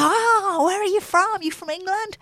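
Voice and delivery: high, excited American voice; American shop staff. Retold or imitated. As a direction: gush at her like a delighted local. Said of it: "They go".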